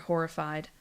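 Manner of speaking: ordinary delivery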